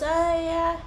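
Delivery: put-on high, halting little-kid voice; little kid stalling at the ice cream counter; shy little kid. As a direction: stammer like a bashful small child. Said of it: "the little kid goes".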